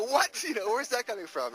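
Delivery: high, loud puzzled what; suddenly baffled by the noise; puzzled director. Retold or imitated. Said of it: "and George would go".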